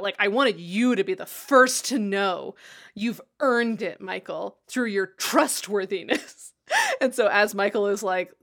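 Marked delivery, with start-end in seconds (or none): 0.2-6.5 slow, emphatic mock pronouncement; making a big scene. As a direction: lay it on thick, stretching the words out. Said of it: "Makes a big scene about".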